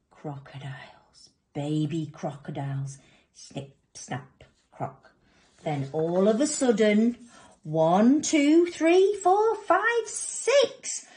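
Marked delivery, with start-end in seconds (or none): 0.1-5.1 low, soft reveal; hushed suspense. A narrator's reading. Storyteller guesses what is in the eggs, hushed. 7.6-11.0 climbing higher with every number; excited counting. A narrator's reading. Storyteller counts hatching crocodiles, excitement climbing.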